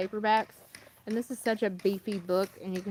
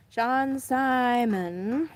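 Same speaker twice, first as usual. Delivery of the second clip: very slow, drawn-out name; careful enunciation; 3 semitones higher, 3 dB louder, much slower than usual. Real, not acted. Vlogger retrying a tricky name after tripping over it, very slow and careful. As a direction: stretch it out carefully after stumbling. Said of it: "I don't have a lisp".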